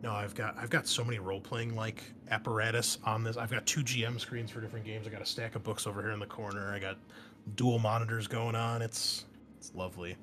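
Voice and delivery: ordinary talk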